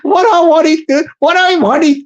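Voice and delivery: high, loud and giddy; worked up. Real, not acted. Pitching his idea to the council woman, worked up and giddy. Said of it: "I've got to calm down here".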